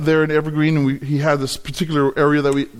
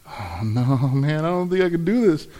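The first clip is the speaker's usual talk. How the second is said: retold dread; 4 dB quieter than usual. Retold fear before letting go.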